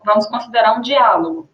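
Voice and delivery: usual speaking voice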